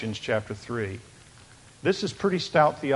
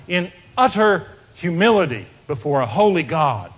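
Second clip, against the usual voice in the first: loud, raised preaching to the congregation; fervent preaching; 7 semitones higher, 9 dB louder than usual. Acted. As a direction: proclaim it, rising and louder. Preaching at full force.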